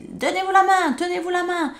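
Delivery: high, pressing teacher voice; insisting teacher. Retold or imitated. Teacher ordering pupils to hold hands, insistent and high. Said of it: "le professeur insistait".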